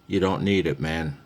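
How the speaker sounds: ordinary talk, nothing marked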